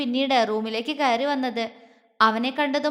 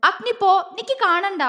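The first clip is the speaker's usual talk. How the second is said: higher, flustered push-away; shaky, shy protest; trembling; 7 semitones higher than usual. Acted. Girl unable to bear Agni's gaze, trembling higher. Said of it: "വിറയലോടെ പറഞ്ഞുകൊണ്ട്".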